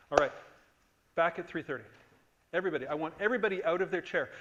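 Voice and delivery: loud, fast call to get everyone moving; loud announcement to a room on break. Real, not acted. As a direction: project over the room to send them on break. Calling class back from break.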